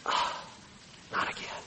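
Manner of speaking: soft, breathless, heartbroken sigh; quiet pained murmur of God; grieved sigh. Acted. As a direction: let it fall away almost unvoiced. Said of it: "It's not that God's going".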